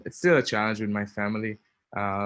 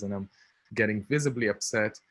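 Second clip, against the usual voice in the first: ordinary talk, nothing marked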